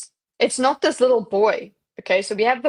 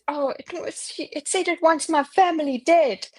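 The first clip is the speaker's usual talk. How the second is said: put-on eerie child voice; 7 semitones higher than usual. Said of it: "And it says".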